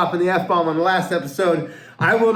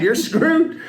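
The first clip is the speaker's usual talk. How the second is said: higher, laughing as he delivers it; punchline through laughter; 10 semitones higher than usual. Acted. Joke teller laughing through the lifeguard's punchline. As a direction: crack up on the punchline.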